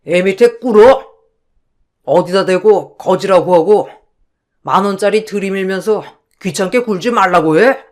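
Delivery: full-throated angry telling-off; furious scolding. Acted. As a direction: bellow it out, throwing her out of the house. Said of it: "크게 호통치며".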